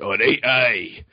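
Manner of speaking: broad, drawn-out Scottish accent; thicker Scottish. Shown as a demonstration. Guest mocks own accent when abroad, exaggerated thick Scottish. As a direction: lay the Scottish on thick, slowly. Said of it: "I get thicker".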